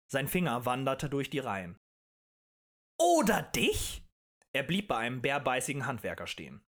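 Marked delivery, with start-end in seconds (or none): 3.0-4.0 slow, high, drawn-out question; provoking rise on the last word; menacing challenge. A narrator's reading. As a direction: stretch it out and single someone out. Agitator points at another listener.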